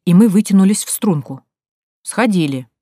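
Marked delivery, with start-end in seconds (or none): 2.0-2.7 meek answer. A narrator's reading. Girls answer the suspicious watchman, meekly. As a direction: answer obediently with a falling tone.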